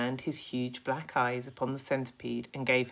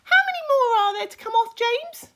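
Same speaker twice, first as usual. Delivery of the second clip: much higher, louder cocky insect voice; a boastful little bug, pitched way up; Centipede voice; 11 dB louder, faster than usual. Acted. Centipede, fast.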